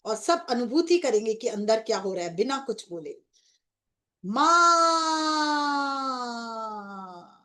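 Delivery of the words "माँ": long, loud, tearful call of Maa, higher; crying call to mother. Acted. Devotee calling the divine mother again, tearful shout. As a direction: pour everything into Maa. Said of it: "अब मेरे साथ एक बार और बोले".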